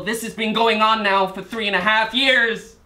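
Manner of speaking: building to a high-pitched, angry outcry; mounting anger. Acted. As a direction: let pent-up frustration climb into shouted accusation. Defendant's anger builds at the court.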